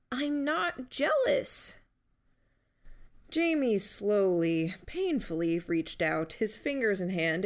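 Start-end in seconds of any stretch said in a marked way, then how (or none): 0.1-1.8 high, drawn-out insistence; protesting at being teased, pitched up; defensive denial. Acted. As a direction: deny it slowly in a much higher pitch. Defensive denial after teasing.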